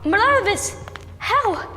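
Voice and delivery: high, loud cry of shock; startled at the villain's sudden arrival; shocked outburst. Acted. Agent shocked to see Malavis appear, high loud startled cry.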